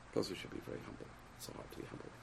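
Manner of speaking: soft unvoiced murmur; hushed aside to the class; whispered aside. Real, not acted. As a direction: drop to a near whisper. Quiet aside on humility.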